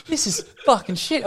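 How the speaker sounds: high, put-on pleased voice; unchanged fake delight. Showing the face stays the same, fake delight. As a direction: keep the high gift-opening voice whatever the gift. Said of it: "it stays the same".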